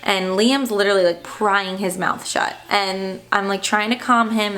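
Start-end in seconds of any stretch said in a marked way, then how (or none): none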